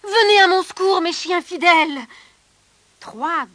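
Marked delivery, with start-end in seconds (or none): 0.0-2.0 loud, high cry for rescue; urgent call. Acted. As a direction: call out in desperation. Soldier summoning dogs. (appel pressant, fort et aigu)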